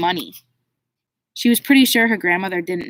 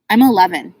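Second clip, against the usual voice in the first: curt and louder; rudely; 7 dB louder than usual. A narrator's reading. Girl rebuffs condescending warden, rude and louder. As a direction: snap it back, offended. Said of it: "Martine said rudely".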